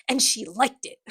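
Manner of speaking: lightly voiced smug creep; slimy villain purr; creepy villain voice. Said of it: "This is the Sebastian voice".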